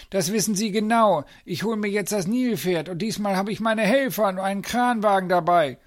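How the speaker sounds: high, loud, put-on blustering voice; indignant threat. A narrator's reading. Zoo director comes to fetch the hippo, indignant. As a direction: threaten him in a high-pitched huff.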